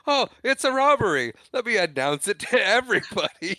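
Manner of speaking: high, loud hammed-up alarm breaking into laughter; mock panic. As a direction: play a panicking teller, way up in pitch. Retold teller stumbling on a robbery.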